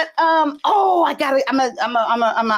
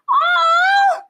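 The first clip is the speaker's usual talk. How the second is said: surprised cry; 3 dB louder than usual. Real, not acted. Sees a photo of the couple.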